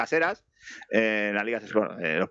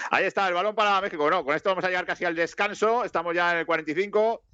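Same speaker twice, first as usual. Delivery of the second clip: higher-pitched running match commentary; live play-by-play; 5 semitones higher than usual. Acted. Football commentator updates listeners near halftime, live play-by-play.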